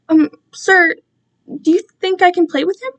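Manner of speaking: put-on high, fast, eager little-boy ask; childlike pleading. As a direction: beg sweetly like a small child. Kai asks like a child.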